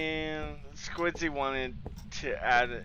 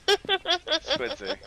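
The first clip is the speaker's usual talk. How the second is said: much higher, giggling through the name; high laughing squeak. Shown as a demonstration. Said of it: "But Squidzy".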